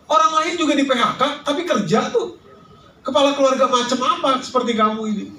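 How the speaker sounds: put-on high-pitched wifely reproach; changed intonation, no real emotion. Shown as a demonstration. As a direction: scold a jobless husband in a high, pressing voice. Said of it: "tapi int-, intonasinya saya rubah".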